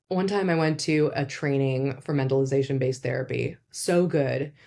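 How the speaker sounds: ordinary talk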